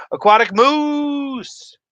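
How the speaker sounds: high, loud, slow shout; excited shout. Real, not acted. Streamer hollering a viewer's name in excitement. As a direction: belt the name out, drawn out.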